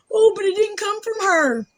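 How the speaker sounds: high-pitched mocking retort; mocking excuse. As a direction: parrot their excuse in a high mock voice. Mockingly voicing the other side's excuse.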